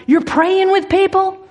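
high-pitched incredulous question; imitating a loud, challenging woman; mock loud disbelief. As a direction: echo it back high, as if announcing it to all. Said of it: "she says very loudly".

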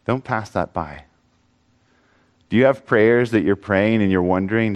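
normal talking voice

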